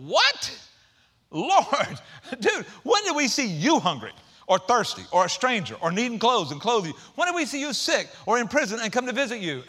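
high, loud disbelieving protest; a bewildered outcry from the crowd; astonished righteous. Acted. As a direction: act baffled and amazed.

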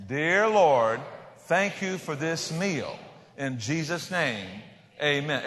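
slow, drawn-out phrases for a group to repeat; mock-difficult lesson, each word stretched out; slow lead-along prayer. Shown as a demonstration. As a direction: lead everybody through it very slowly, pausing after each phrase. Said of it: "Say it with me, everybody. This is hard".

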